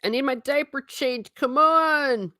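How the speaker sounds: high-pitched whiny mock baby demand. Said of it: "also goes back to babies".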